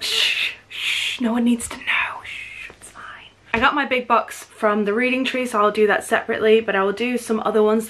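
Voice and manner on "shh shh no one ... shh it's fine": playful shushing in a whisper; conspiratorial whisper. Pretend. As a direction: hush the listener as if hiding a secret. Mock secret shared with viewers.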